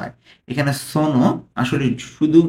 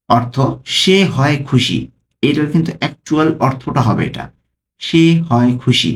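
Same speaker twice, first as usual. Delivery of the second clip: usual speaking voice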